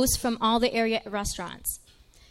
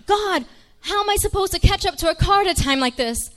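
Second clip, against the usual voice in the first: high, loud desperate plea to God; frantic prayer; 8 semitones higher, 6 dB louder than usual. Retold or imitated. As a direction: pray out loud in a panic. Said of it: "I start praying".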